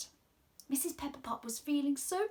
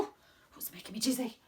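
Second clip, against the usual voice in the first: whisper. Real, not acted. Aside after loops.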